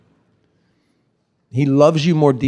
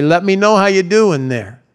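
higher-pitched mock call-out; calling from doorway; 6 semitones higher, 4 dB louder than usual. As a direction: call in casually from a safe distance. Imagined bystander calling from the doorway.

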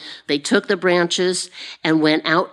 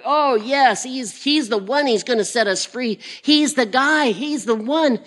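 high, singsong acted jubilation; rejoicing crowd; 8 semitones higher than usual. Crowd glorifying Jesus as the one who'll free them, rejoicing. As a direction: play an overjoyed follower, pitch swooping high. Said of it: "they're rejoicing over him".

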